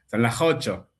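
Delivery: Son las ocho with the final s aspirated. Shown as a demonstration. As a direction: drop the s of las into a breath h, Santa Fe style. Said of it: "aspirar las eses finales".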